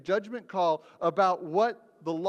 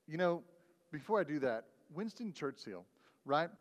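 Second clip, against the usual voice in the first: usual speaking voice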